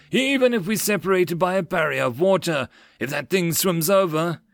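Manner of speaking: a mild roar, pitched high. Acted. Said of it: "the John roared as he hammered".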